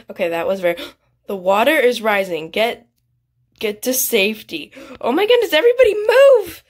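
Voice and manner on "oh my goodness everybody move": high, loud cry of alarm; urgent shout. Real, not acted. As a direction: yell to clear everyone out. Urging players away from rising water.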